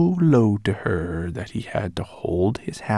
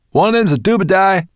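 high, loud, cheeky brownie voice; squeaky brownie; 12 semitones higher, 11 dB louder, faster than usual. A narrator's reading. Brownie answering the dragon, high and cheeky. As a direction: pipe it up like a bold little brownie.

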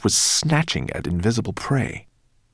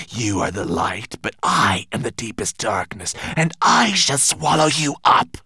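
threatening monster; 6 semitones higher than usual. Acted.

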